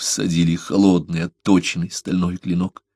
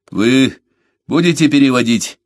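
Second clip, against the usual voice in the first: raised, louder impatient question; 6 semitones higher, 6 dB louder than usual. A narrator's reading. Беккер presses the translator to begin, impatient. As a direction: ask it impatiently, pitched up. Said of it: "нетерпеливо спросил Беккер".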